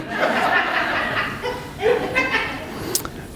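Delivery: high breathy laugh after the punchline. Real, not acted. Pastor laughing at his own tissues joke, high laugh. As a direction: let a real laugh burst out.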